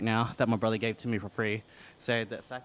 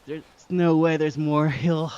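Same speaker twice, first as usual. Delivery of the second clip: loud, slow, disbelieving groan of a line; fed-up cyclist facing yet another hill; exasperated; 3 semitones higher, 8 dB louder, slower than usual. Real, not acted.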